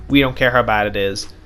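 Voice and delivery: usual speaking voice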